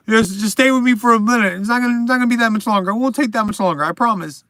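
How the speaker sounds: ordinary talk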